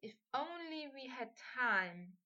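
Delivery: soft, slow, drawn-out regret; hushed, teasing wish; wistful murmur. A narrator's reading. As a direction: murmur it close, lingering on the words. Draco's wistful reply in intimate scene.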